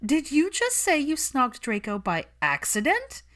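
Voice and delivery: high, loud and slow in disbelief; can't believe what she just heard; shocked disbelief. Acted. Pansy stunned by the confession.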